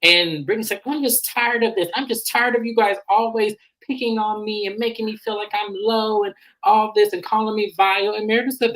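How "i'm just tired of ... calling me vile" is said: high-pitched mock complaining; retold aggrieved complaint. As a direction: play someone fed up with being picked on. Acted quarrel, Brittany complaining.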